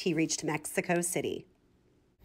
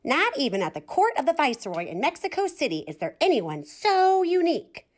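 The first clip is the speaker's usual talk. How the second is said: swallows gossiping; 6 semitones higher than usual. A narrator's reading. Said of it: "talking about him".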